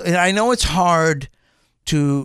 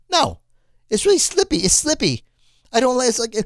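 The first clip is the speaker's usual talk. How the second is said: high-pitched indignant complaint; exasperated protest; 8 semitones higher than usual. Retold or imitated. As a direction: re-enact your frustrated objection, pitched way up. Retells own complaint to salespeople.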